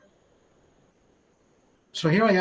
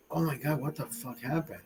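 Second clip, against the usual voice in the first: hushed inner voice; 4 semitones lower, 6 dB quieter than usual. Retold or imitated. Panicked inner thought after falling.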